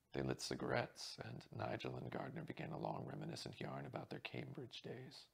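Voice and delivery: usual speaking voice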